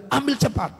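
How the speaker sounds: acted urgent order, high pitched; re-enacted brisk command.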